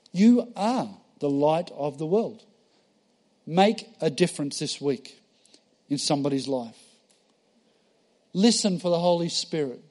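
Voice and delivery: ordinary delivery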